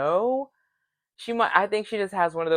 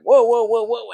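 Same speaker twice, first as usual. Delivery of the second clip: loud, higher, rapid run of whoas; alarmed interjection; 5 semitones higher, 7 dB louder than usual. Retold or imitated. Josh stepping into a couple's fight, loud alarmed halt. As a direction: jump in to break it up.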